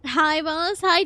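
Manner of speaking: ordinary talk, nothing marked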